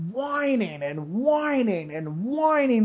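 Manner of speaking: mock the whiners with a slow, lilting drone; drawn-out mock whining. Complaining about TV news, mocking whining, drawn out. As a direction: stretch whining out slowly in a sing-song tone.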